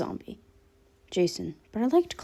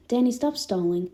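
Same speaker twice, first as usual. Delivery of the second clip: usual speaking voice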